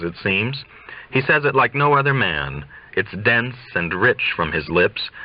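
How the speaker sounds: ordinary delivery, nothing marked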